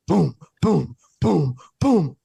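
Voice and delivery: loud, punchy booms; imitated banging. Storyteller imitating banging heard from his room, loud booms. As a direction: mimic heavy thuds on a door. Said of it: "I hear boom".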